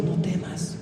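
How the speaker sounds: God's quiet word to the listener; a soft, intimate "No temas"; hushed comfort. Acted. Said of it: "Dios te está diciendo".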